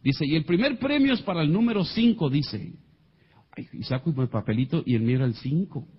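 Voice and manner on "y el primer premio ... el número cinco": prize announcer. Retold or imitated. Manager announcing first prize to the guests, announcer style. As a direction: imitate a high-pitched raffle announcement.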